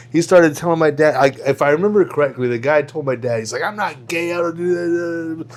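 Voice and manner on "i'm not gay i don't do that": mild mock protest, slurring into a ramble; retold freak-out. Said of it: "freaked out on him".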